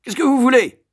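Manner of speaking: high, loud fierce demand; yelling. A narrator's reading. Matron confronting the boy in her room, yelling. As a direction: bellow it like a fearsome matron. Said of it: "hurla la surveillante".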